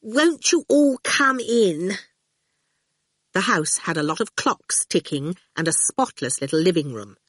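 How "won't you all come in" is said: high, slow, grudging courtesy; cross politeness. A narrator's reading. Miss Potter to uninvited callers, cross politeness. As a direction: invite them in slowly through forced manners. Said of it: "with cross politeness".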